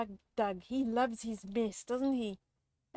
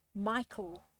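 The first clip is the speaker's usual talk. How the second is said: usual speaking voice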